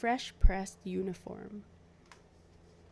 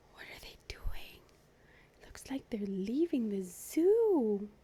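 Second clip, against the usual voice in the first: whispered. Real, not acted. Reader whispering to the listening kids. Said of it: "reader whispers aside to kids".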